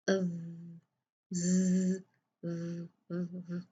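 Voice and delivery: "ज़" repeated, first buzzing then soft; z versus dhal contrast. Shown as a demonstration. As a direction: says "ज़" again and again, showing the difference. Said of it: "फर्क है ना". ("ज़" को बार बार बोलकर z और ज़ाल का फर्क दिखाना)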